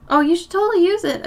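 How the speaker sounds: high, fast mock enthusiasm; sarcastic. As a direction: oversell it with fake cheer. Sarcastic aside while painting.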